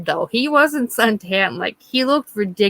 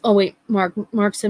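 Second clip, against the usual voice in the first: normal talking voice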